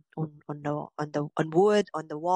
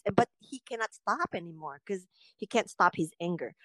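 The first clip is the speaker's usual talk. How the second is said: usual speaking voice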